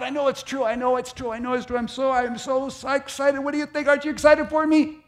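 high, bubbling and insistent; can't contain the joy; giddy excitement. Acted. Abram begging Sarai to share his thrill, high and giddy.

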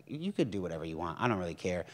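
low, soft, unbothered; calm defiance. Retold or imitated. Preacher answering the devil, low and unbothered. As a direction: say it quietly like it can't touch you.

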